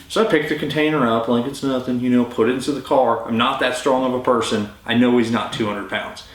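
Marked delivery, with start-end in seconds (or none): none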